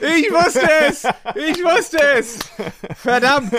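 high, slow triumphant outcry; excited. Real, not acted. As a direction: shout it out, caught out. Reaction to cheap wine reveal. (aufgeregter Ausruf)

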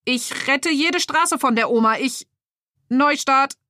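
high, jerky toy voice that breaks off; glitching superhero. Acted. Superhero figure garbles its boast and restarts, high and jerky. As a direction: mix up the words and reboot.

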